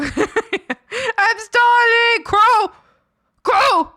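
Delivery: high, loud drawn-out yell for food; starving pet shouting. Pretend. Voicing hungry pet demanding food.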